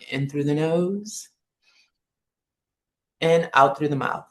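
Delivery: calm, unhurried guide with long pauses between phrases; guided meditation. Acted. Jedi teacher guides students lying on the floor through breathing, calm meditation voice. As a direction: ease them through each breath, lifting gently on the in-breath.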